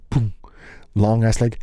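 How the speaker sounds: ordinary talk, nothing marked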